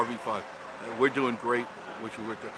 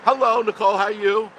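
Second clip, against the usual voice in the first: much higher and louder, bursting with delight; excited greeting; 11 semitones higher, 11 dB louder than usual. Real, not acted. Vlogger greeting a viewer by name, gushing and excited. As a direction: greet her like a long-lost friend.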